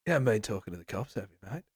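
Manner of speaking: quick soft Aussie menace; fast, soft Australian threat. Retold or imitated. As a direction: lean in like an Aussie crook. Repeats Mendelsohn line.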